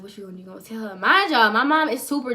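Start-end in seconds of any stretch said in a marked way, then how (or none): 0.0-1.0 hushed, worried mutter to yourself; quiet self-talk. Retold or imitated. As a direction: whisper it under your breath. Scared self-talk at night.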